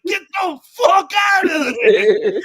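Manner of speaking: high, drawn-out mock scream; loud, slow, high shout; panicked yell. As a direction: holler a desperate warning, hammed up. Acted panicked yell in retelling.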